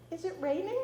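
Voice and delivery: put-on high curious child's question; little boy voice. A narrator's reading. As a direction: ask innocently like a small boy. Toddler asks grandpa.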